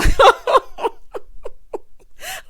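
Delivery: high, loud burst of laughter; real uncontrolled cackle. Real, not acted. Host cracking up at the handcuff line, loud laughter.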